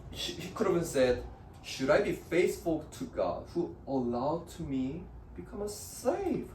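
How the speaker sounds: ordinary delivery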